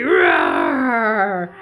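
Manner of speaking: long, drawn-out play roar; big roar. Pretend. Carer roaring along with the child, big play roar. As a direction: pretend to be a roaring lion. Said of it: "Show me your big roar".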